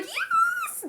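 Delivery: a sudden high squeal of delight; excited cheer. Real, not acted. Cheering her favourite on while watching, excited. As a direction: squeal with joy.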